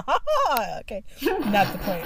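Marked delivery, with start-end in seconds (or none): 0.0-0.8 "ha ha" lifted higher with a push; mock gloating "ha ha"; emphasized ha ha. Shown as a demonstration. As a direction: lean into "ha ha". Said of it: "put some emphasis on the".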